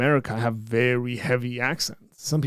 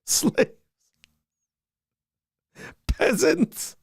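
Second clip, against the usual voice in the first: high, loud mock address to underlings; mock-haughty proclamation; 6 dB louder, much slower than usual. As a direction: call it out high like a hammed-up lord. Whatever else [name] calls non-kings.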